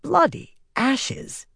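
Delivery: slow, high-pitched exclamation of dismay; aghast oath. A narrator's reading. Swan realizes he senses her feelings, aghast cursing.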